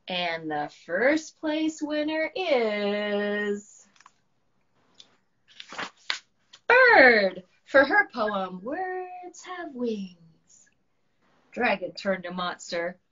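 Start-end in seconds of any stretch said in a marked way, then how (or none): 0.1-10.7 drawn-out announcer build to the winner; slow suspense announcement. Acted. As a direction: announce slowly, then louder on the name. Miss Llama announcing first place.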